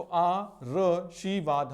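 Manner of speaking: "आ र शी वाद" very slow, r before shee; syllable-by-syllable misreading. Shown as a demonstration. Teacher shows where misplaced r lands, "आ र शी वाद" slowly syllabled. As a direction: sound out "आ र शी वाद" piece by piece. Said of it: "रा को पहले पढ़ेंगे".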